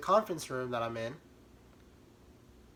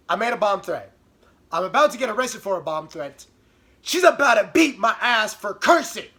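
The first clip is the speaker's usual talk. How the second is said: loud, punched restatement; disbelieving rant; exasperated emphasis; 5 semitones higher, 8 dB louder than usual. Real, not acted. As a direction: hammer each phrase. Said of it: "restating the absurdity".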